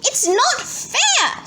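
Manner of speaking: put-on high, drawn-out childish protest; mock screech. A narrator's reading. Henry protests being sent out, high drawn-out screech. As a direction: screech it slowly, stretching the complaint. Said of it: "screeched Henry".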